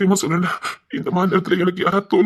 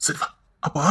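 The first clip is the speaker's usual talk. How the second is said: soft whisper; 5 semitones lower, 7 dB quieter than usual. Acted. Father soothes daughter.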